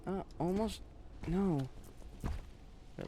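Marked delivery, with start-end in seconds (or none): 2.2-3.1 breathy whisper, no voice at all; whispered wait. Real, not acted. Gamer whispering to himself mid-fight. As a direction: hush it out as you focus on the enemy. Tense moment in the game.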